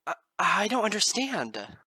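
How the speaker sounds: high, loud, faltering protest; crying out. Acted. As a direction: stumble over it in distress. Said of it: "I cried".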